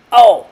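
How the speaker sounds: loud, high "oh" of a kicked ball; sudden exclaimed yelp. A narrator's reading.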